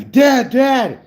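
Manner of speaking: put-on high, loud child's cry for dad; imitating screaming kids. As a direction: yell for dad like a panicked kid. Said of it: "were screaming at me".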